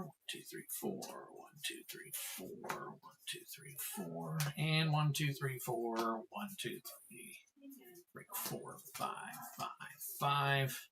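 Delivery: counting under the breath; whispered counting. Real, not acted. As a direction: whisper the numbers to yourself. Counting sleeves under his breath.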